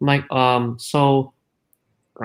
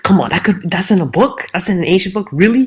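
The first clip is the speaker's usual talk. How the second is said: louder, higher, sneering disbelief; mock incredulous scoff; 5 semitones higher, 6 dB louder than usual. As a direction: mimic his scoffing dismissal, hammed-up. Mocking a dismissive atheist's scoff.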